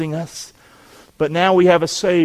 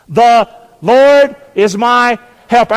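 raised, slow call to repeat; leading congregation; 5 semitones higher, 8 dB louder, slower than usual. Shown as a demonstration. Preacher asks congregation to say the verse with him, leading congregation. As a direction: lead them in a loud, measured declaration. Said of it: "say it with me".

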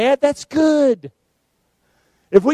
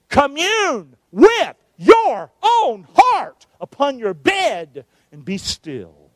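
slow recitation; 3 semitones higher, much slower than usual. Real, not acted. Congregation recites scripture together.

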